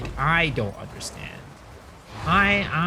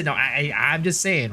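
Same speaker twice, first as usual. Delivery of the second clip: normal talking voice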